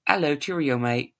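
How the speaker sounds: loud, jaunty mock-British greeting; British accent. Demonstrating how italics might sound, in a mock British accent. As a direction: put on a cheery British accent. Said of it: "am I talking in a British accent".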